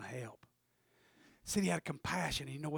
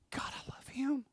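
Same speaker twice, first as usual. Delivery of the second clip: soft whispered confession; 6 semitones higher, 6 dB quieter than usual. Acted. Wounded man whispering his change of heart. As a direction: breathe it out.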